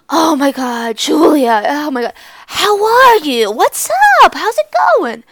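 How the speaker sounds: gasping, loud, high gushing hello; hammed-up excited squeal of recognition; over-the-top greeting. Classmate greeting Julia at the coffee shop, mock overexcited. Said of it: "He's like".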